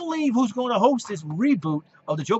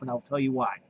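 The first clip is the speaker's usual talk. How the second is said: normal talking voice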